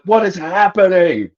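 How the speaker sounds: confused cry at the world spinning; bewildered cry. Retold or imitated. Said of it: "And I was like".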